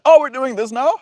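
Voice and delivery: high, loud, gleeful cosmic voice; the universe taunting a fearful person, gleeful and high; voicing the universe. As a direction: play the universe eagerly raising the stakes. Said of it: "The universe is like".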